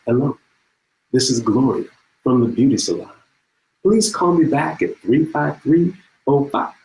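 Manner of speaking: leisurely caller, spacing out each piece; slow phone message. Acted. Gloria from the beauty salon leaving a message, slow and unhurried. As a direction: imitate an unhurried answering-machine message, pausing between parts. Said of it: "the first message sounds like this".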